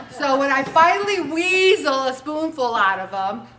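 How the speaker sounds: normal talking voice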